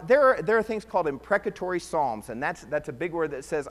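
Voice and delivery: ordinary delivery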